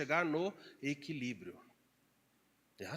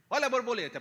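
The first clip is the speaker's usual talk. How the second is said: giddy delight; 6 semitones higher, 5 dB louder, faster than usual. Retold or imitated. Mimics overly cheerful person.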